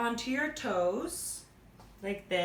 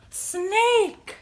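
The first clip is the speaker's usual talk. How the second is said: high, drawn-out animal reveal; excited reveal; 7 semitones higher, 4 dB louder than usual. Pretend. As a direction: announce it brightly to children.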